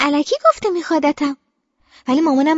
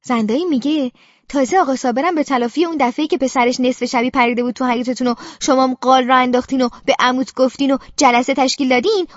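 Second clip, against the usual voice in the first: usual speaking voice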